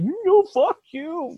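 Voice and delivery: high, slow drawn-out curse; lightly hammed-up old man snapping; mock Biden retort. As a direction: drag out the insult like an old man. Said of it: "Biden's gonna be like".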